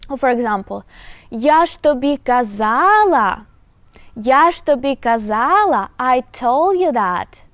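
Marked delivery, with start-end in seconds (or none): 1.3-5.9 я ж тобі казала said louder, ж leaned on; emphatic reproach. Shown as a demonstration. Teacher demonstrating an emphatic I-told-you, loud. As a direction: press hard on ж тобі казала. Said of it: "You put emphasis on it".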